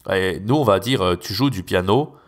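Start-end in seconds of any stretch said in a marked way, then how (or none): 1.2-2.1 tu joues du piano said flat, little rise; plain, monotone French question; level French question melody; flat French intonation. Shown as a demonstration. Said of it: "avec beaucoup plus, euh, flat".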